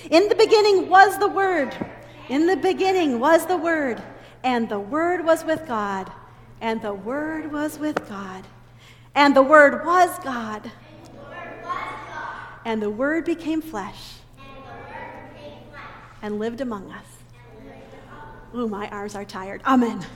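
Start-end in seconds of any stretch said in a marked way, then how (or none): 0.1-1.7 louder and higher, leading the group; loud call to repeat. Shown as a demonstration. Teacher calling the verse out loud for the children to repeat. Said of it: "Will you repeat after me?". 2.3-17.0 call-and-response recitation. Shown as a demonstration. Teacher leading children through the verse, measured recitation. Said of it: "Will you repeat after me?".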